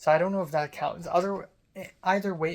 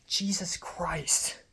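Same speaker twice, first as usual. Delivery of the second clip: exasperated whisper; much slower than usual. Real, not acted. Exasperated under breath.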